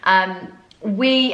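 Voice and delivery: ordinary talk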